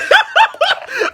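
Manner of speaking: real high-pitched burst of laughter; squealing laugh. Real, not acted. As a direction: crack up at your own story. Laughs at own story.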